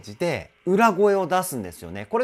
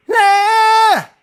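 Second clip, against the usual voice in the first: very high and much louder punchy note; powerful mix voice; 14 dB louder than usual. Shown as a demonstration. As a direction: hit the high note with force. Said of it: "これも裏声なんですよ".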